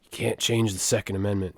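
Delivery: low, dropped mock American accent; Australian doing American. Redoing the bit for co-host, low Australian-does-American accent. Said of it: "'Cause I'm doing the voice".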